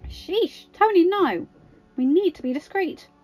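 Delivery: exclaiming. A narrator's reading. Natasha reining Tony in before the bell, exclaiming. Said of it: "Natasha exclaimed".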